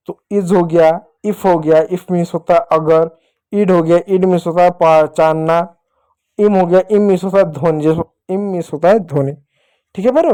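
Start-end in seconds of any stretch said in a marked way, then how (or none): none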